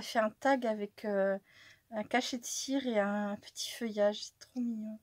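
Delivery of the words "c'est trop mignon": whispered in awe at the gift. Real, not acted. Admiring the cute gift in a hushed whisper. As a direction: breathe it out as a soft whisper.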